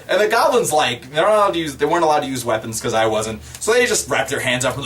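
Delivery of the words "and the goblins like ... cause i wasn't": ordinary talk, nothing marked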